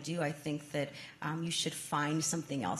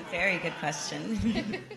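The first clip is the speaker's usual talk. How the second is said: amused reply through laughter; laughing; 4 semitones higher than usual. Real, not acted. Answering a child's question about advice to a younger self, laughing. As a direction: laugh as you say it.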